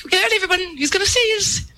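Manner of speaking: high, mock-panicked announcement; alarmed warning. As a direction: re-enact bracing everyone for an emergency. Retold alarmed warning to passengers.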